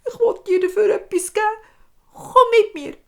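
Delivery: a scared little dwarf bargaining; squeaky sobbing dwarf voice; high crying dwarf. Acted. Frightened dwarf pleading.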